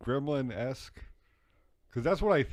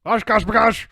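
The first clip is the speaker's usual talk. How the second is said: put-on loud, high angry German accent shout; 11 semitones higher, 11 dB louder than usual. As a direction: bark it in a hammy German accent. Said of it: "Say it in a German accent".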